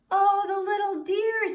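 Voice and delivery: high, loud delighted coo; cooing wife. Acted. Wife coos at the piglet on the doorstep, high and delighted. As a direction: coo over a tiny piglet.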